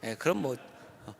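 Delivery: usual speaking voice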